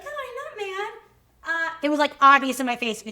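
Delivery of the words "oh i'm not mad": high sing-song "I'm not mad", clearly faking it; fake breezy denial. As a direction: chirp it brightly while obviously annoyed. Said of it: "And I used to be like".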